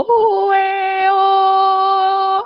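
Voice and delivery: loud, high long call across a field; hollering out to the flock; shepherd's call. Retold or imitated. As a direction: call the sheep from far away. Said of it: "algo así hace el pastor".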